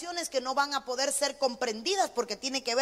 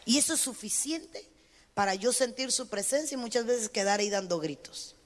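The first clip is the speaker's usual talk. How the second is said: ordinary delivery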